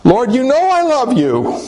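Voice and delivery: high, pleading protest; agonized. Retold or imitated. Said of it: "in agony".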